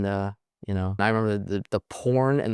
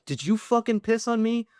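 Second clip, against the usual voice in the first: sharp, much higher pitched accusing question; outraged accusation; 12 semitones higher than usual. Retold or imitated. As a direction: demand an answer, voice jumping high. Confronting his buddy, outraged.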